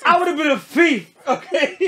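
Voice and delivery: laughing boast. Real, not acted. Joking about stealing.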